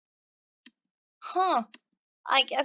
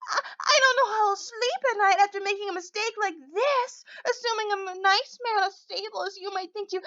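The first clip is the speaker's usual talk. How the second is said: mock whimpering; 7 semitones higher than usual. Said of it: "You might be trying to mock me".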